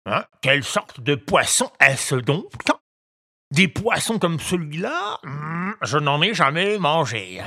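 high, puzzled grumbling ogre; mutter, puzzled by a strange fish; grumbling fisherman. A narrator's reading. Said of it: "grommela inquiet". (voix d'ogre qui grommelle, intrigué)

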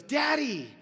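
put-on high, loud happy shout of a little boy; excited child shout. Son spotting his dad in the doorway, loud happy shout. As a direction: call out gleefully on seeing dad. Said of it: "Dex sees me and goes".

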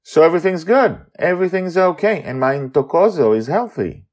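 Amali, relieved. Acted. Amali checking all is well, quick high voice. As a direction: rush out the relief.

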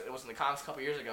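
fast correcting rattle; rushed correction. Retold or imitated. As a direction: rattle off the fact. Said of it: "I'll be like".

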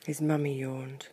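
plain reading voice

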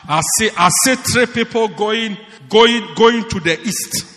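loud, halting prophet act; mock prophesying. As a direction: imitate a preacher calling out prophecies. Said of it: "sit there and say".

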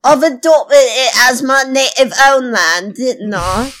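hammed-up fake British accent; put-on British accent. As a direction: welcome listeners in a badly faked British accent. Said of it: "Sounds very natural".

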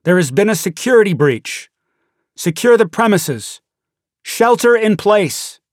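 loudspeaker alert. Retold or imitated. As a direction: boom it out like a building-wide security alert. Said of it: "the emergency alert come from a loudspeaker".

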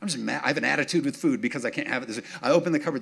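ordinary delivery

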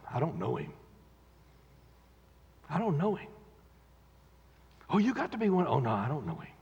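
quiet, sheepish denial. Retold or imitated. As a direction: shrink from the question. Peter's denial, contrasting boast.

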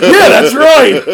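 high, loud, laughing and slow; laughing mock reply. To cohost, recalling his thought, laughing high. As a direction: blurt it out through laughter. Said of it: "I was like".

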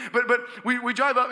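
usual speaking voice

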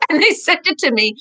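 ordinary delivery

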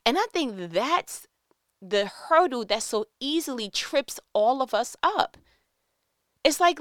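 ordinary talk, nothing marked

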